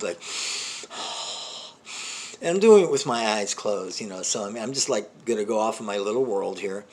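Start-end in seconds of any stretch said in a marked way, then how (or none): 0.2-2.3 long, forceful breathing exhale; deep breath. Shown as a demonstration. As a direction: hyperventilate with a deep, heavy breath. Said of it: "breathe deep".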